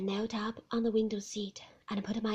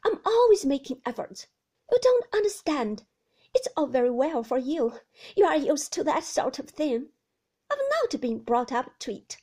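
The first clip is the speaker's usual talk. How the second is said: put-on high, pleading young woman's voice, louder; anxious young wife; 4 semitones higher, 10 dB louder than usual. Young wife pleading with Maxim about visitors, higher and louder. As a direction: plead to be understood, voice lifted high.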